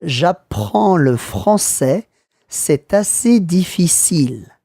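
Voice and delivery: slow, careful "J'apprends le français, c'est assez difficile"; teacher's slow model of "J'apprends le français, c'est assez difficile"; slow repeat. Shown as a demonstration. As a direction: say "J'apprends le français, c'est assez difficile" slowly for the learner to hear every word. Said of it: "Listen again".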